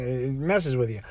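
ordinary talk

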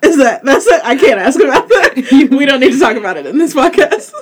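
high, loud, laughing backpedal. Real, not acted. Host to guest about the dream question, laughing. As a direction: tease while giggling through the words. Teasing after an awkward question.

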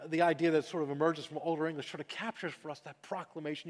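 usual speaking voice

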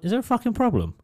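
high mock challenge; hammed-up tough-guy challenge. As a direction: act the hard doorman. Retold confrontation.

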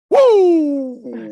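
high loud whooping Flair cry; Ric Flair woo. Retold or imitated. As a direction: let it rip like the wrestler. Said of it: "As the great Ric Flair would say".

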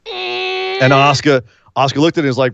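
ordinary talk, nothing marked

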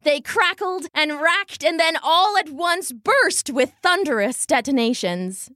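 ordinary delivery

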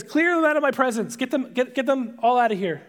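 a half-sobbing command to clear the room; mock urgent order. Joseph ordering servants out while weeping.

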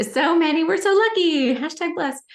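high, loud, sugary gratitude; mock gushing. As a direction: gush it sarcastically, pitch way up. Sarcastic gushing about blessings.

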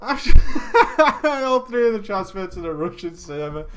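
slow, loud words through laughter; helpless laughing delivery. Real, not acted. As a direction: crack up while telling it. Laughing while telling the disaster.

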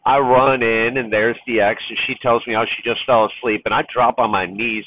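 normal talking voice

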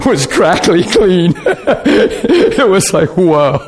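laughing through the words; can't hold back the laughter. Real, not acted. Preacher laughing as he recalls the moment. Laughing while telling healing story.

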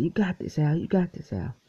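high-pitched encouragement, retold; imitated cheering crowd. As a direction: lightly mimic supporters cheering someone on. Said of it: "So everybody said".

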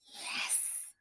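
secret whispered cheer; voiceless private exclamation; mouthed, whispered. A narrator's reading. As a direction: whisper it to yourself, barely audible. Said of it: "toward the window and mouthed".

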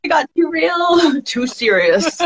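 high, drawn-out words breaking into laughter; teary giggle through the words; tearful laughing. Real, not acted. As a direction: laugh it off while tearing up. Tearful laughing after crying moment.

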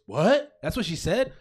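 high, loud incredulous question; shocked disbelief. Retold or imitated. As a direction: imitate a friend's stunned "what?!". Retells Mike's shocked reaction.

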